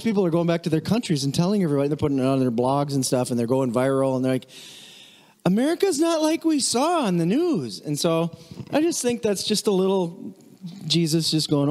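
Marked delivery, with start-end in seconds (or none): 5.5-7.8 high, wide-eyed mock revelation; amazed visitors. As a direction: announce the discovery like a stunned tourist. Visitors report back home.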